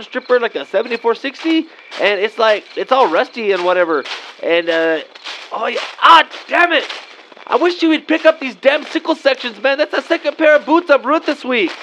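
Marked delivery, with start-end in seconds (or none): 5.5-11.7 high-pitched, pained complaint; exasperated cursing. Acted. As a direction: curse after hurting your foot, voice raised high. Trips over metal in yard.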